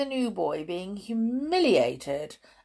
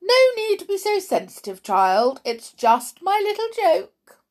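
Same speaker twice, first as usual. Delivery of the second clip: high, loud, dismissive; mocking matron; 13 semitones higher, 7 dB louder than usual. A narrator's reading. Matron dismisses George's protest, mocking. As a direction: brush him off with theatrical scorn.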